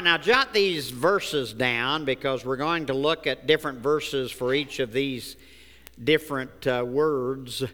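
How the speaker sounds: normal talking voice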